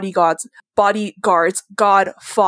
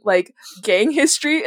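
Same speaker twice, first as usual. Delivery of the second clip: usual speaking voice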